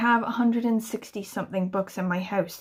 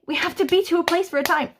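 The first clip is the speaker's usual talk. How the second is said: higher, faster exasperated parent; 7 semitones higher, faster than usual. Retold or imitated. Mum repeating the rule to Spud in rising exasperation. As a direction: rattle the rule off in frustration. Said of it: "How many times do I have to say".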